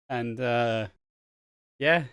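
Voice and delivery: ordinary delivery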